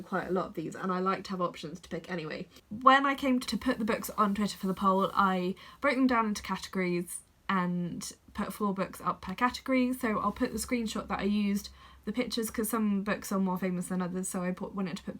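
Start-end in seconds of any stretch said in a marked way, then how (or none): none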